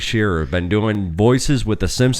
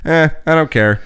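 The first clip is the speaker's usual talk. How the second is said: higher-pitched imitation of not caring; mock indifference; 8 semitones higher than usual. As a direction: shrug it off, mimicking someone who can't be bothered. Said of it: "he was just kind of like".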